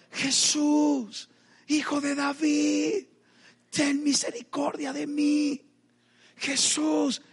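high, drawn-out pleading call; crying out. Retold or imitated. Blind beggar calling to Jesus over the crowd, crying out. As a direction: call out as if from afar, slowly. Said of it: "con tu clamor".

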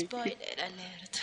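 low, slow, sensual whisper; sultry narrator. Pretend. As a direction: purr it out slow and seductive. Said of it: "con voz sensual lo vamos a hacer". (voz sensual lenta y grave)

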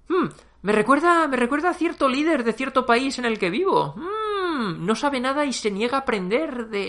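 high, knowing hmm's; sly sarcasm. Acted. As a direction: fake innocent musing. Sarcastic hint at a leader. (insinuando con sarcasmo a un líder, con hmm agudos)

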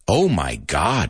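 usual speaking voice

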